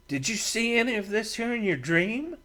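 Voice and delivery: probing a friend about a dream; Matt, curious. A narrator's reading. Matt questions Brent.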